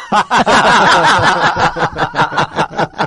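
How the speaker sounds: real laughter breaking through the words; telling it while still cracking up; high, loud words through laughter; laughing hard. Real, not acted. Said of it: "empecé a llorar de la risa".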